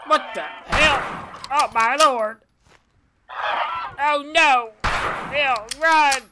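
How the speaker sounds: high, loud, frightened cries; real panic, voice up high and loud; panicked. Real, not acted. Player panicking at the monster birds, loud and high.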